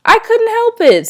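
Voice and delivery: put-on high, whiny child protest; whining. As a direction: whine it out like a scolded kid. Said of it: "kind of whining".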